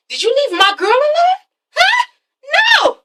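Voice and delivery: high-pitched defiant questioning; mock indignation. German squaring up to Derek over his girl, mock indignant. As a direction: throw it back in disbelief, voice shooting up. Acted defiant retort in quarrel.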